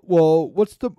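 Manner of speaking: high, loud put-on character voice; Klaus Schwab voice. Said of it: "that's my Klaus Schwab voice".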